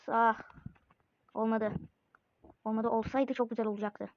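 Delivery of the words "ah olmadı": ordinary talk, nothing marked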